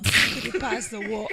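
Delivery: slow, exaggerated Nigerian lilt on the question; Nigerian-sounding accent. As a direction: ask for the water in a put-on Nigerian accent. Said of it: "That sounded more Nigerian than it did English".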